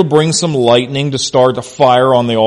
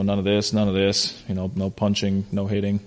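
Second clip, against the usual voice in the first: low, much softer aside; rote referee rules; 5 semitones lower, 12 dB quieter than usual. Retold or imitated. Referee reciting rules to boxers, rote and quiet. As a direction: lightly imitate a ref rattling through rules, under your breath.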